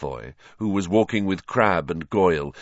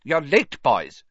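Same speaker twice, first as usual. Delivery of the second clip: high-pitched mild scolding; reproving; 6 semitones higher, 3 dB louder, slower than usual. A narrator's reading. Professor Flitwick scolds latecomers at the classroom door, reprovingly. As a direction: chide them, starting high. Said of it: "said Professor Flitwick reprovingly".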